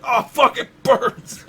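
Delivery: high, loud pained cry; pained yelp. Pretend. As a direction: yell like it stings. Acting eye hit.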